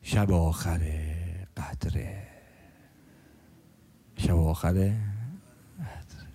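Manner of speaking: low, soft, slow hushed words; hushed solemn. Acted. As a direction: let it sink in, barely above a breath. Solemn emphasis on last night. (زمزمهٔ آرام و پایین)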